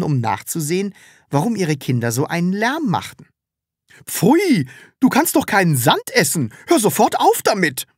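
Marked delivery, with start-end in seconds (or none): 4.1-7.8 high, loud scolding cries of the mothers; mothers calling out. A narrator's reading. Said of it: "riefen sie durcheinander".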